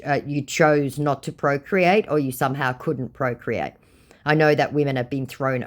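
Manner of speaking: ordinary talk, nothing marked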